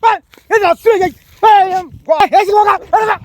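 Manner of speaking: high, agitated shouting; fly at him with repeated high-pitched challenges; real angry outburst. Real, not acted. Provoked man threatening to hit the pestering stranger, furious. Quarrel turns to threatened blows.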